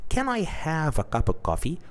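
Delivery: polite request. Shown as a demonstration. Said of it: "So you ask them by saying".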